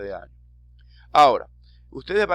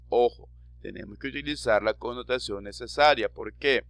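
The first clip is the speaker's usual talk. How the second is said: ordinary talk, nothing marked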